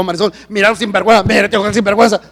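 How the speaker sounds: loud, worked-up retold indignation; bravo. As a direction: rant to your wife, hammed-up. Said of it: "yo soy bien bravo". (indignado, quejándose a voces)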